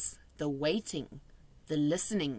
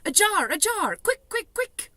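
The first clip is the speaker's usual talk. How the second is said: high, hurried calls for a jar; excited giant hurrying Sophie; urgent call; 14 semitones higher, 5 dB louder than usual. A narrator's reading. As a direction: urge her on, quick.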